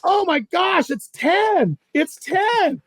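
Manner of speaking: very high, breakneck enthusiasm; overexcited imitation. Partner guesses the weight of his fish, overexcited. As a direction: imitate a wildly overexcited angler.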